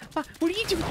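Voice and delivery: ordinary talk, nothing marked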